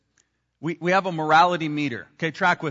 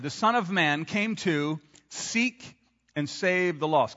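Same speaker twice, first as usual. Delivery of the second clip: slow, deliberate, weight on "save"; the true verse, said slowly and clearly; slow emphatic quote; slower than usual. Shown as a demonstration. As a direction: read it slowly, each word weighed. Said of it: "Here's what he actually said".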